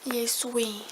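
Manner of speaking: whispered, breathy aside; hushed little whisper about him. Real, not acted. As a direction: whisper it so only the camera hears. Cooing over someone off camera.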